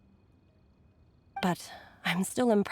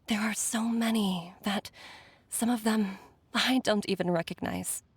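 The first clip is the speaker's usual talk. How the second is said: ordinary talk, nothing marked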